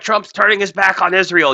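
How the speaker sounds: mock claim. Mockingly voices critics' claim.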